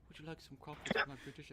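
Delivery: whisper. Retold or imitated. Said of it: "He just whispers".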